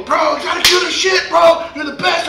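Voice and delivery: high, loud imitation of hyped-up fans; mimicked high-pitched cheering on; excited fans. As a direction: hype him up like an amazed crowd. Voicing excited fans urging him.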